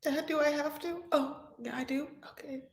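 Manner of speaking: high, soft, whimpering reluctance; a mock sob of a daughter forced to say yes; mock reluctant sob. Bride to her dad, mock despairing whimper. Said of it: "that cry was this?".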